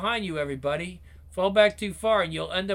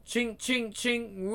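chain clinks; 4 semitones higher, 5 dB quieter, much slower than usual. Acted.

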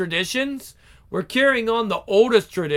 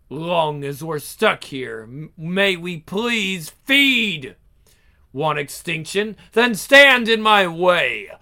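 ordinary delivery, nothing marked

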